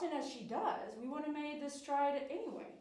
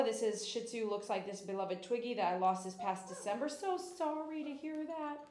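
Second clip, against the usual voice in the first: ordinary talk, nothing marked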